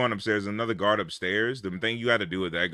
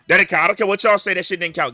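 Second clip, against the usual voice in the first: louder and higher, refusing to accept it; indignant shout; 9 semitones higher, 10 dB louder, faster than usual. Real, not acted. Gamer yells at his friend that the failed run didn't count. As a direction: shout it down, it did not count.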